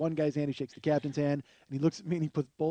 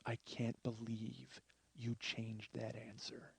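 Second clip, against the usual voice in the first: very soft, slow, low reproach; hushed disbelief; 6 semitones lower, 17 dB quieter, slower than usual. Retold or imitated. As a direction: lean in and say it under your breath. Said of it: "just leans forward and goes".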